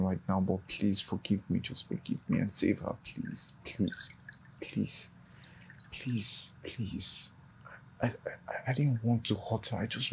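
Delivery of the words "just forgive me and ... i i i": soft, unvoiced pleas; hushed repeated pleading; whispered begging. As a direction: beg God under your breath.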